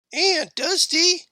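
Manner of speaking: put-on high-pitched young kid calling for attention; kid's voice. A narrator's reading. Kid interrupting Aunt Dusty, high and insistent. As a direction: pipe up high, like a child interrupting.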